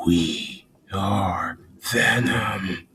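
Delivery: deep, whispery monster voice, very slow; drawn-out, breath-heavy symbiote growl; Venom. Shown as a demonstration. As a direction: rasp each word out long and deep. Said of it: "Can you do a Venom voice?".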